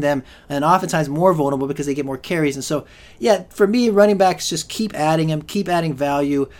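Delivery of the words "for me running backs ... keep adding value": normal talking voice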